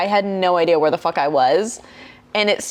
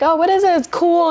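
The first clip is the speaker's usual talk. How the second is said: curious excitement; 9 semitones higher, 4 dB louder, faster than usual. Retold or imitated. Acted curious excitement at installation.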